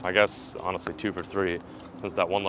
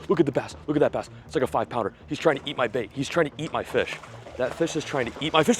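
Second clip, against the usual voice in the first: excited; 6 semitones higher, 4 dB louder than usual. Real, not acted. Big bass chasing his catch.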